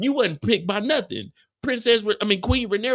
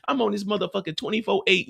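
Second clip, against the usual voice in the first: normal talking voice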